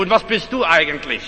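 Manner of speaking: normal talking voice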